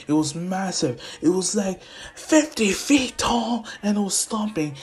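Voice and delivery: high-pitched thrill, drawing out the size; excited bragging. Acted. Son telling Dad about the robot, excited. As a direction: build up the giant with rising excitement.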